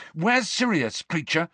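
put-on young Harry pressing hard; demanding.

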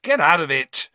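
loud, high, cross shout; irritated yell; angry. A narrator's reading. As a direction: shoo the cat away angrily. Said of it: "shoved Crookshanks angrily away".

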